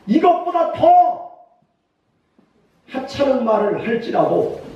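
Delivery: high-pitched pleading with a superior; urgent remonstrance. Retold or imitated. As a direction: plead high and insistently, holding him back. Acted aides restraining their angry general. (간곡히 말리며 높은 소리로)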